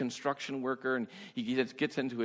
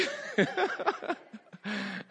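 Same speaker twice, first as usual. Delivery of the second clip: high laughter. Real, not acted. Laughing at the gymnast image.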